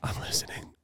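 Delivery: hushed prayer whisper. Acted. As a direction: breathe it, barely voiced. Hushed joke during prayer.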